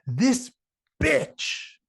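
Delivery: high, loud, contemptuous jab at the clerk; angry customer. Retold or imitated. Rude customer points at the checkout girl, high loud contempt. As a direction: spit it out in disgust, pitch shooting up. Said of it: "this is the first thing she says".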